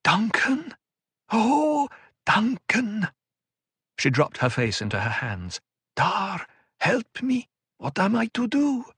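high anguished cry of pain; clutching chest in pain. Acted. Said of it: "Pain clutched her chest".

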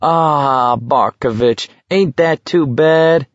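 mocking sympathy, pitched high; high, slow jeer; calling back. A narrator's reading. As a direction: draw it out in fake pity. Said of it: "Kintz called back".

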